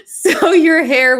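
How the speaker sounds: words squeezed through a laugh. Real, not acted. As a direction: still giggling while finishing the line. Laughing at her own story.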